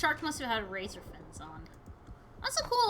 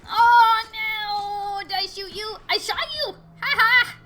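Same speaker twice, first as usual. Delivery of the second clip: high, loud wail turning to crowing; mock dismay into triumph; 9 semitones higher, 12 dB louder, slower than usual. Real, not acted. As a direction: cry out, then gloat with a laugh. Player hits the target, cheers.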